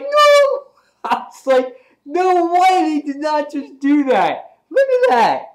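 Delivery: high, loud, stretched-out disbelief; incredulous outburst at full volume; shocked shouting. Retold or imitated. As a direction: yell like a fan who can't believe it. Reliving shock at the panel.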